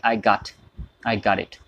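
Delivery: "got" with an aa vowel, American style; American English, gaat. Shown as a demonstration. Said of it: "सीधा आ का sound आ जाता है". (American लहजे में "got", आ के साथ)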